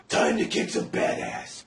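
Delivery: slow, voiceless rough rasp; Batman's disguise growl. Acted. Batman heading off to fight Bane, disguise growl. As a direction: growl it as the masked hero.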